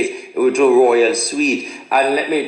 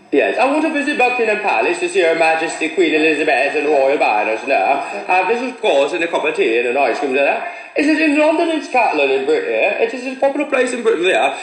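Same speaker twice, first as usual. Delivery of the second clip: mock upper-class British, fast and lilting; proper British accent. Visitor wanting rich England announces palace visit, mock upper-class British accent. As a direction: put on a hammed-up royal English accent.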